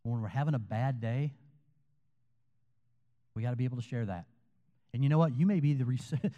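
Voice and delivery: ordinary talk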